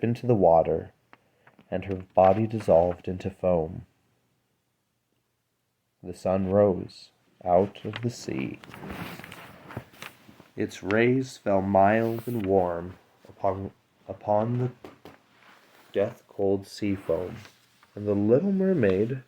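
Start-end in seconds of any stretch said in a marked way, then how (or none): none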